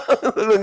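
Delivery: short high chuckle; amused laugh after the punchline; real laugh. Real, not acted. Laughs at own story.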